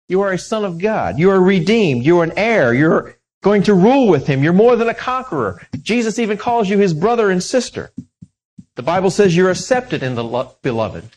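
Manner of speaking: loud, rising sermon proclamation; the preacher tells the congregation who they are, in a loud, fervent preaching voice; preaching at full force. Acted. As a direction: build it up like a preacher declaring truth.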